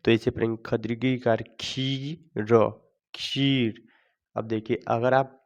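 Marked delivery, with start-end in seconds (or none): 0.7-3.8 खीर read with a lengthened ee; drawn-out long vowel. Shown as a demonstration. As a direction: spell it out slowly, holding खी long. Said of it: "थोड़ा खींच करके पढ़ेंगे".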